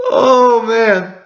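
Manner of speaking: high, loud overwhelmed cry; real excited cry. Real, not acted. Reactor overwhelmed by the chapter, excited cry. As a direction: let it burst out of you.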